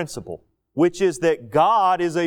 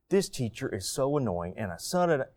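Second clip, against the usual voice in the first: softer, lower, muttered to oneself; inner thought; 5 semitones lower, 8 dB quieter than usual. Retold or imitated. Student privately grumbling about the teacher, muttered low. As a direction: grumble it privately under the breath. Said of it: "I don't imagine to have a thought".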